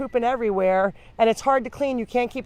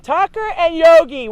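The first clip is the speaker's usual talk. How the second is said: much higher, louder call across the room; calling the dogs; 8 semitones higher, 10 dB louder than usual. Real, not acted. Rescuer calls out to playful dogs Tucker and Yogi, loud and high.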